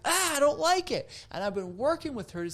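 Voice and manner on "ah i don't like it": put-on high, loud little-girl protest; dad imitating his five-year-old's loud, high protest; imitating angry daughter. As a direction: do your five-year-old stomping her foot. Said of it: "she's like".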